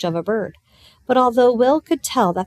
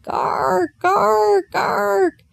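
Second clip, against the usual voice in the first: high, harsh cawing croaks; rook caws; 12 semitones higher, 3 dB louder, much slower than usual. A narrator's reading. Rook calling to Will.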